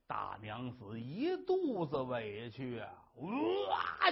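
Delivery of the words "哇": sudden high wail of crying; wailing burst. Acted. Wife bursting into tears before her husband, sudden wail. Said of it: "就哭出来了".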